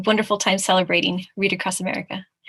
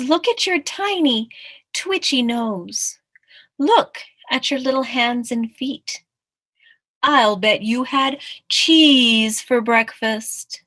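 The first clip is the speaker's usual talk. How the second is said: slightly raised, drawn-out taunt; lightly voiced teasing tiger; 4 semitones higher, 5 dB louder, slower than usual. Tiger picking on the mouse's tiny features, lightly teasing. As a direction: tease with stretched key words.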